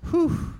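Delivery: high, blown-out "whew"; heavy exhaled whew. Real, not acted. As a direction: let out a whew before something hard. Catches himself before a hard point.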